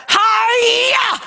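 high-pitched "hi-ya" battle cry; mock martial-arts shout; karate yell. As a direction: shout as you land the chop. Acts out karate chop yell.

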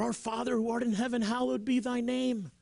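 high, hurried mock recitation; mock urgent praying; imitated fervent prayer. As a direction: rattle off a desperate prayer on one high note. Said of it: "they're praying fervently".

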